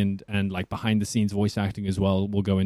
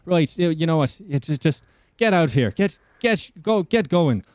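ad-libbed pub banter; 2 semitones higher than usual. Acted. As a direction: tease and send off a mate in the bar. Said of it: "if I were to go into a bar".

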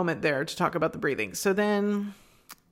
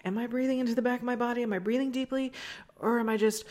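higher, quick run of mock-unsure questions; unsure self-questioning; 5 semitones higher, faster than usual. Yoga student unsure about their breathing, lightly acted self-questioning. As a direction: lightly act out someone checking their breath. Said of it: "or you're not sure, like".

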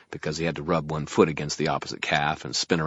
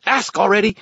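high, loud, impatient fairy yell; screaming fairy; 10 dB louder than usual. A narrator's reading. Fairy screams at wizard to hurry, impatient. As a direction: fire it off in a high squawk. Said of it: "Toot screamed".

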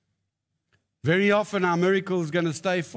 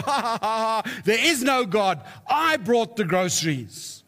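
raised, laughing triumph; mock gloating laughter; 5 semitones higher than usual. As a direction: gloat as the neighbour who caught her out. Atheist gloats in joke.

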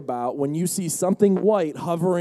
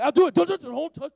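high, agitated, stumbling repeats; flustered protest; 10 semitones higher, 4 dB louder than usual. Acted. Peter telling friends not to touch him, flustered. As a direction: fend off pushing hands.

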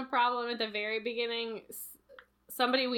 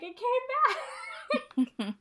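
very high, drawn-out, laughing; slow delighted squeal; 11 semitones higher, much slower than usual. Retold or imitated. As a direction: stretch it out in gleeful relief. Retold reply, slow high laugh.

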